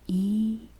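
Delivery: full, drawn-out "I" vowel; normal I. Shown as a demonstration. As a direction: say "I" as a long, clear ee. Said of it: "This is I normal".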